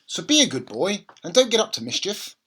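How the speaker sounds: busy mum heading out the door; mother's voice. A narrator's reading. Mother voiced in read story.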